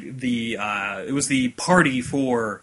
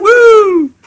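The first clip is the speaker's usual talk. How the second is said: loud, high-pitched whoop; high cheering holler; excited whoop; 15 dB louder than usual. Real, not acted. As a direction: let out a big celebratory "woo". Whooping as podcast jubilee joke.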